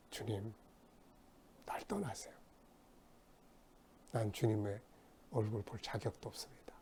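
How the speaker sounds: humbled hush. Retold or imitated. Peter humbled before Jesus.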